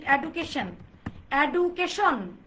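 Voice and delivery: education, এডুকেশন as e-du-ke-SHON, hard d and full o; common mispronunciation. Shown as a demonstration. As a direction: say এডুকেশন slowly with plain du and SHON. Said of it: "এটা কি এডুকেশন হবে?". (এডুকেশন ভুল উচ্চারণে, ড আর শন স্পষ্ট)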